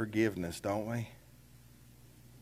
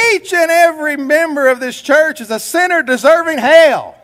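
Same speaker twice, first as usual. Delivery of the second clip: high and loud, a preacher's angry roar; cranked-up volume; 10 semitones higher, 9 dB louder than usual. Retold or imitated. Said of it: "so he cranked up the volume".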